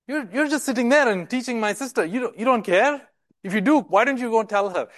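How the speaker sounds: high, pleading accusation at Jesus; accusing complaint. Retold or imitated. Martha complaining to Jesus about her sister, accusing and high.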